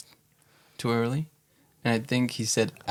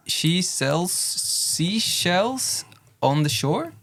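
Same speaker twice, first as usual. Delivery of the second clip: slow, higher, word-by-word attempt; careful tongue twister; 7 semitones higher, much slower than usual. Shown as a demonstration. Player having a go at a tongue twister, slow and careful. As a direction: pick through the tongue twister slowly, questioning at the end. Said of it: "have a go at the tongue twister".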